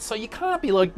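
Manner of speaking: normal talking voice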